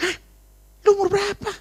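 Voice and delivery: high-pitched, startled question; mock shock, voice shooting up; shocked reaction. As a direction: act out stunned disbelief. Acts own shock at confession.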